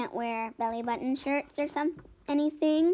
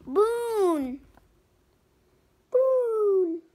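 tiny hippo voice; 7 semitones higher than usual. Acted. Hippos name the balloon.

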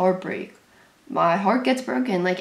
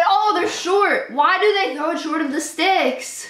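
exasperated fan outburst; 9 semitones higher than usual. Real, not acted. Fan reacting to a short throw, exasperated. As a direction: protest the play with pitch jumping up.

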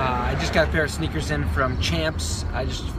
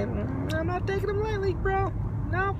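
high excited squeal. Real, not acted. Hyped squeal.

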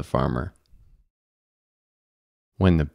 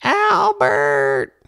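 wife yelling. A narrator's reading. Farmer's wife yelling to get her husband up. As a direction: holler his name up the stairs. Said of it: "the farmer's wife yelled".

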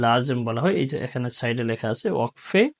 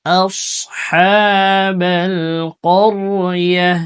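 slow, louder chanted recitation; Quran recitation; 5 semitones higher, 4 dB louder, much slower than usual. Shown as a demonstration. Teacher reciting the verse for students. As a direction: draw every word out in recitation.

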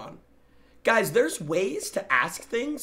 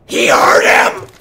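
loud stretched yell; shout; 5 semitones lower, 14 dB louder, slower than usual. Real, not acted. Streamer reacting to a clip, shouting. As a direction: yell it out in shock.

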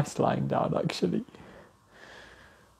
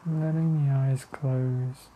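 slow, even meditation voice with long pauses; guided meditation; 5 dB quieter than usual. Real, not acted. Leading listeners to the breath, calm meditation voice. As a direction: guide attention inward, unhurried and steady.

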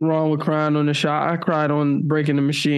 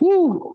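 high "woo"; 13 semitones higher than usual. Acted. Overwhelmed reaction to painful story.

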